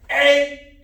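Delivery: sharp, high kihap yell; martial arts kihap. Shown as a demonstration. Instructor demonstrating an eye gouge for students, kihap shout. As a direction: shout it out as you strike. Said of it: "Eye gouge and key up".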